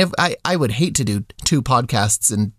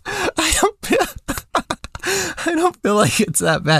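laughing through the words, much higher; 13 semitones higher, slower than usual. Real, not acted. Laughing at cohost's anger about the puppet's feet. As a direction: giggle while protesting. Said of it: "you are so mad".

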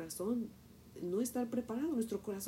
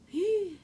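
a sudden high frightened gasp; sharp gasp; 11 semitones higher, 8 dB louder than usual. Retold or imitated. A mother spotting the wasp coming at her, sharp frightened gasp. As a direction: gasp as the wasp comes at you.

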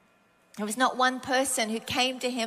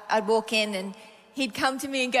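ordinary talk, nothing marked

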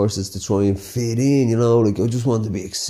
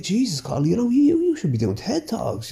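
smug inner voice; 10 semitones higher than usual. Retold or imitated. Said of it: "I was like".

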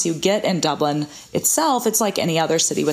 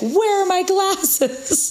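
high, slow, drawn-out mom voice; a lost mom wailing for her glasses; panicky mom; 12 semitones higher, much slower than usual. Retold or imitated. Mother hunting for the glasses on her own head, high and slow. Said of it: "she'll be like".

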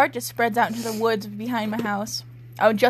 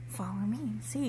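much softer, hushed and eerie; spooky whisper; 11 dB quieter than usual. Shown as a demonstration. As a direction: breathe it like a ghost beckoning. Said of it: "I think I meant, like".

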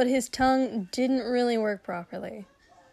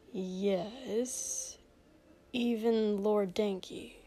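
slow, soft wary answer; suspicious; 6 dB quieter, much slower than usual. A narrator's reading. Prince answering his servant's leading question, suspicious. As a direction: draw it out warily. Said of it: "said suspiciously".

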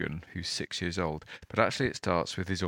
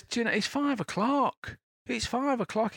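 exasperated uncle. Retold or imitated. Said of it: "He says".

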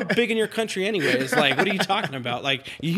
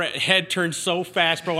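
ordinary talk, nothing marked

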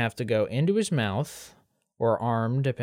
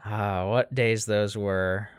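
slow wistful sigh; hammed-up nostalgic sigh; mock wistful; 3 semitones lower, much slower than usual. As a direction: linger over the memory.

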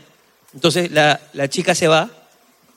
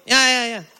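higher, trailing dismissive ya-yas; sleepy brush-off; 3 semitones higher, 5 dB quieter than usual. Retold or imitated. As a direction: wave the maid off half asleep, voice fading. Brushing off maid while half asleep.

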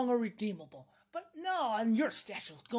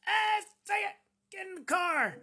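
calling out from car; 8 semitones higher than usual. Retold or imitated. Friend calling from car window.

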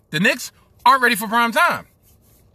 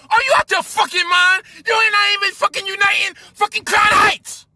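angry shout; 8 semitones higher than usual. Real, not acted. Fan yelling back at Kyrie's unity talk, angry shout. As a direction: shout him down in disbelief.

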